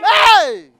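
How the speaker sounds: sharp loud hey; husband shouting to silence his wife; loud cry. Acted. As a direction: cut her off with a shout. Husband cries out in protest.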